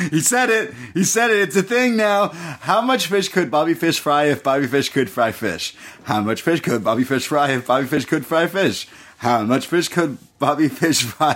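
gleefully repeating the tongue twister; imitating excited Riddle. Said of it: "he starts to sing it".

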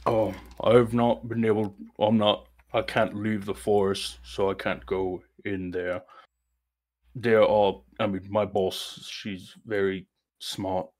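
lightly voiced ancient tree, hesitant; a hint of a giant tree, unsure and wary; tree character voice.

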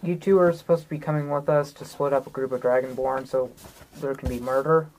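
ordinary talk, nothing marked

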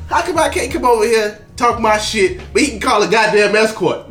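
usual speaking voice

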